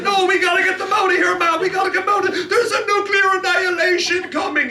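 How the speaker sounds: high, loud laughing shout of alarm; panicked shout. Retold or imitated. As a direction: yell that annihilation is coming. Acted quarrel, panicked urging.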